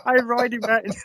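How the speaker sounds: high-pitched giggling breaks up the words; can't get the sentence out for laughing; real helpless laughter. Real, not acted. Remembering the friend on the horse, laughing helplessly. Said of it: "I got memories flashing back, and it was just hilarious".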